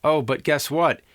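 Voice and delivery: ordinary delivery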